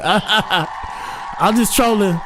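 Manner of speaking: high, drawn-out laugh into the words; laughing disclaimer. Real, not acted. Host laughing off his joke about dangerous women. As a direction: laugh first, then stretch it out high.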